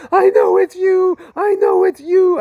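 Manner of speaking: high, loud ecstatic scream; overwhelmed shout of recognition. Retold or imitated. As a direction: cry it out at the top of your lungs in awe. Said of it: "I started screaming at the top of my lungs".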